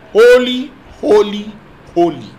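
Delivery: holy shouted high and loud, fading down; seraphs' mighty cry. Acted. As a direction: cry out holy like an angel shaking the door. Seraphs' cry acted in sermon.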